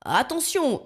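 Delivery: high warning yell. A narrator's reading. Blackbird warns his passengers, high yell. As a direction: shout a sudden warning. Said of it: "hurle Zigomar".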